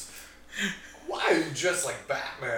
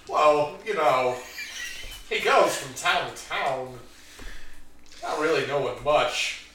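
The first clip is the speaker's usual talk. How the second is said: narrator voice; 4 dB louder than usual. Acted. Narrator intro of wandering character.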